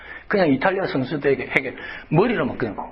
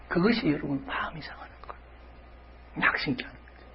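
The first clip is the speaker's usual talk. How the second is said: whisper; 9 dB quieter than usual. Acted. Sermon softened emphasis.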